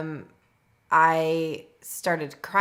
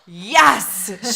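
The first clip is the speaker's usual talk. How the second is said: loud, high cheer of excitement; excited midwife; 4 semitones higher, 10 dB louder than usual. Retold or imitated. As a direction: cheer her on like a thrilled midwife. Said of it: "And she was so excited".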